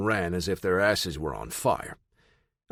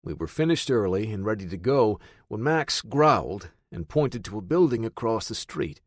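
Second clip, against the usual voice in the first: plain reading voice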